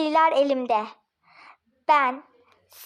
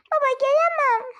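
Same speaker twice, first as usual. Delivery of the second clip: put-on child's complaint; 8 semitones higher than usual. The girl tells her father she cannot come in, in a higher childlike voice. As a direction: whine that you can't come, like a small girl.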